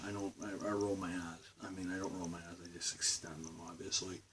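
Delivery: usual speaking voice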